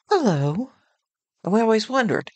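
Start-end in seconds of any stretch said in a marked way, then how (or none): 0.1-0.7 high, lilting imitation of a phone greeting; sugary, mock-polite greeting, rising at the end; sweet little hello. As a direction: answer the phone in a put-on sweet voice. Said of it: "sweet little".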